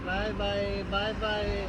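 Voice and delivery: small soft voice for a little one. Real, not acted. Driver says bye to a little girl, soft child-directed voice.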